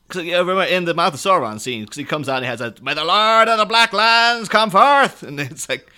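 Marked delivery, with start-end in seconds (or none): 2.8-5.2 mock-heroic high, drawn-out proclamation; put-on warrior's shout, slow and high; Aragorn shouting. Podcaster imitating Aragorn's challenge at the gate, shouting. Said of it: "when Aragorn has to shout".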